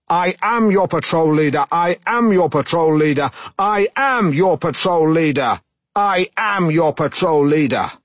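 escalating pompous yell, slowing at the end; repeated shout. Retold or imitated. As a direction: shout it over and over, insisting on rank. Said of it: "I continued to shout this".

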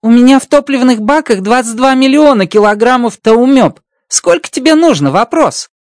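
high, bright-pitched alien voice, amazed; Rocky's alien voice. Acted. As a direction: play the little alien engineer, delighted. Rocky offers fuel.

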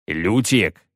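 ordinary delivery, nothing marked

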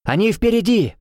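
high anxious cry; nervous cry. A narrator's reading. Finn nervously warns the team, high anxious cry. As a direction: panic that they're ahead. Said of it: "нервничал Финн".

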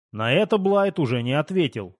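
usual speaking voice

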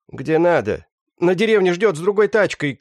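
loud high-pitched yell; shouting thug. Acted. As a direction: scream it out in panic. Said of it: "крикнул он". (громкий высокий крик бандита)

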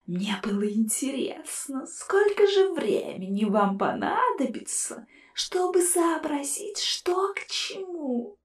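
soft, slow spectral sneer; whispered ghostly scorn; mocking ghost. A narrator's reading. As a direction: taunt them from inside the jar. Ghost skull voice.

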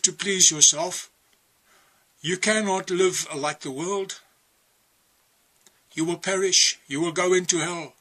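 firm preacher's warning to the flock; preaching. Acted. Preacher warning his listeners about hell, firm preaching. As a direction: drive each warning home like a preacher.

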